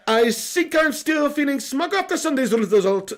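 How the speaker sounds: lightly put-on Portuguese accent; mock Mourinho. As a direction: read it as Mourinho would. Reading Mourinho super chat in voice.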